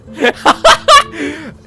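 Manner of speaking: loud, high cackling laugh; high burst of laughter. Real, not acted. Streamer cracking up reading the Reddit post, laughing loud. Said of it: "Stop laughing".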